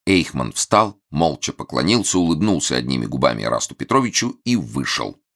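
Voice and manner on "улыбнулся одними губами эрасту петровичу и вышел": ordinary delivery, nothing marked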